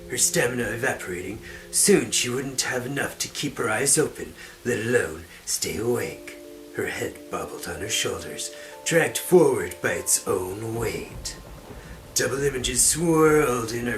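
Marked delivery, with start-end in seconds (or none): none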